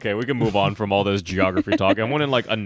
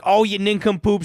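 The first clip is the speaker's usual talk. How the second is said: scolding; 8 semitones higher than usual. Retold or imitated. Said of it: "she's like".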